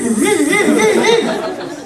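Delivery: high laughing buzzing noise; vibrator buzz. Retold or imitated. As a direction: mimic a buzzing toy while cracking up. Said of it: "It's going buzz".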